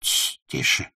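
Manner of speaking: hushed, high-pitched confiding voice; furtive whisper. A narrator's reading. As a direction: whisper it secretly, then shush the listener. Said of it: "И он добавил шёпотом".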